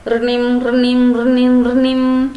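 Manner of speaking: slow sung ring ring of a string phone; a string phone rings up in the sky, slow and sing-song; phone ring imitation. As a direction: chime it like a toy phone ringing.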